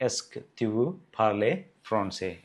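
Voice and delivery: very slow, every syllable spaced; slow French model. Shown as a demonstration. Teacher modelling the French answer slowly for the class. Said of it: "എന്താണ് അതിൻ്റെ answer".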